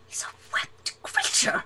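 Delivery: hushed, whispered wonder; awed whisper. Acted. Diver finding the creature has webbed feet, awed whisper. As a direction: whisper it as a discovery.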